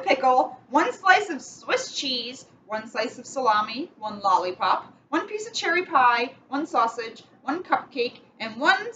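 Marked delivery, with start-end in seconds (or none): none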